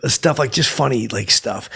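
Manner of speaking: usual speaking voice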